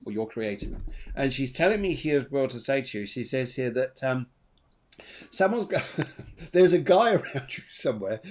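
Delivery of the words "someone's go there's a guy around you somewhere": giggling through the words; real laughter breaking in; chuckling while delivering a cheeky message; laughing. Real, not acted. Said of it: "but I'm laughing".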